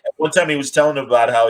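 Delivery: ordinary talk, nothing marked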